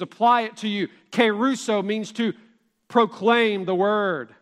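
ordinary delivery

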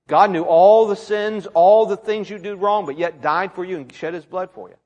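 ordinary delivery

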